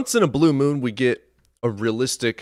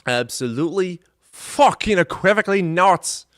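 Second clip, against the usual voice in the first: high, hammed-up British voice; British accent; 8 semitones higher, 3 dB louder than usual. Host joking about voice acting, mock British. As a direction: put on an emphatic mock-British refusal. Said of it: "'cause it sounded British".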